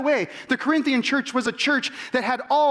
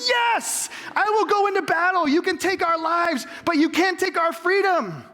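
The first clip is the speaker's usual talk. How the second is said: much higher, rousing battle cry; fired up; 8 semitones higher than usual. Retold or imitated. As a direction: leap up and rally the troops, all fervor. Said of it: "I was, like, standing".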